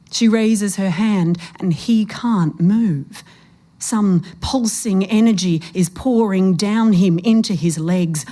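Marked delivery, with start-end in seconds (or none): none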